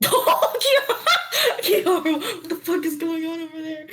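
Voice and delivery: high-pitched, laughing call of a name. Real, not acted. Player calling to a friend mid-game, high-pitched calling out.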